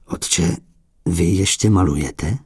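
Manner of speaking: hushed, amazed question. A narrator's reading. Collector amazed the crippled abbot still paints, hushed. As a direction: breathe it out in wonder. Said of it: "s úžasem".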